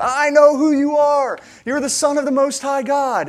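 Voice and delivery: demon-possessed scream. Retold or imitated. Preacher imitating demon-possessed people confronting Jesus, screaming out. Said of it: "They would scream out".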